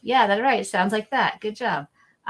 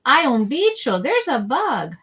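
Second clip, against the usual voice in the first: excited alarm, higher and louder; 6 semitones higher, 5 dB louder than usual. Shown as a demonstration. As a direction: announce a bug with alarm. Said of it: "we say".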